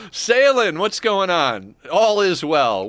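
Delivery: cheery stream welcome; excited greeting. Real, not acted. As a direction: greet a viewer happily.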